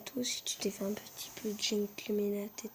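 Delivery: whispered teasing comeback. Real, not acted. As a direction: whisper it back slyly.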